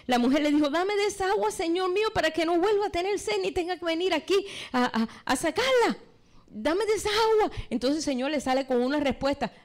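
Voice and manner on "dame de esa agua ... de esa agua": eager pleading. Acted. Woman begs for the living water.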